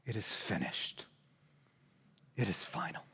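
soft, near-whispered final words; dying last words. Acted. Pastor voicing Jesus' last words on the cross, hushed. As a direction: let the breath run out.

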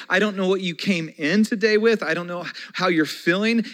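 ordinary delivery

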